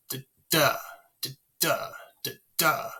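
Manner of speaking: da DA pairs, second da heavier and longer; unstressed then stressed. Shown as a demonstration. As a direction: beat out each da, da as weak-STRONG with a gap between pairs. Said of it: "unstressed by a stressed".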